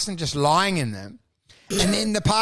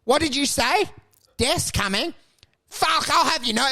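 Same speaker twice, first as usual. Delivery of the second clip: put-on old man; 7 semitones higher than usual. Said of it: "some old guy's gonna go".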